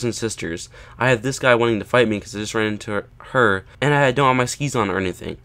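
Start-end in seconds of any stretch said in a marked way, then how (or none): none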